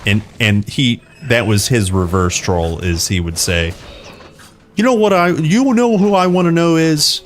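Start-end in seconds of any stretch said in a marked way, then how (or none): 4.8-7.2 high, mock-angry imitation of Dad; pretend-mad dad. Dad reverse-trolling his son, pretending to be mad. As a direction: raise the pitch in fake outrage. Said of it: "yell and, like, pretend he was mad".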